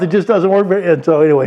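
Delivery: ordinary talk, nothing marked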